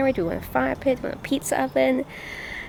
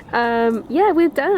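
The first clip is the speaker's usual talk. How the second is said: laughing; 6 semitones higher, much slower than usual. Real, not acted. Laughs at the finished storage.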